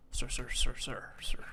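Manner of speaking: "sir" repeated in a whisper with an SH-like S; whispered "sir, sir", all sibilance; weird hissy S. Shown as a demonstration. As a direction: isolate the odd S of "sir", unvoiced. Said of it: "weird S he has".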